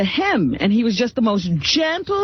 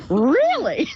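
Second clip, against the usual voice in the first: mock disbelief; 2 semitones higher than usual. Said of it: "the interview goes".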